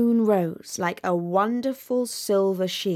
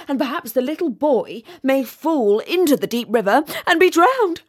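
rising, louder wailing over an imagined tragedy; melodramatic lament; 7 semitones higher, 7 dB louder than usual. Acted. A rocket wailing to the fireworks over an imagined drowning, melodramatic sobbing. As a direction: ham up the grief, voice climbing into a wail.

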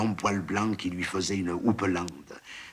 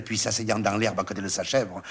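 plain reading voice

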